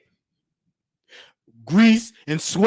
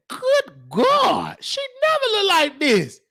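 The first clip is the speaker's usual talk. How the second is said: much higher, astonished put-on voice; mock shocked exclaim; 11 semitones higher, slower than usual. About the news lady's new look, high mock shock. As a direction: gasp it out high like you can't believe it. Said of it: "I said".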